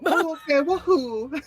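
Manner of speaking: high, loud "oh, okay" into a woohoo; excited whoop. Acted. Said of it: "He's gonna go".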